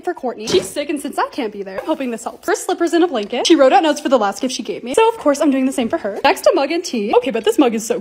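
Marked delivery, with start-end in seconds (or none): none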